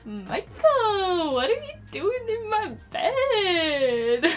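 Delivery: high, slow, shrill quote; quoting a viral video. Retold or imitated. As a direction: cry out the name, drawn out and high. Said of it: "Have you seen that video?".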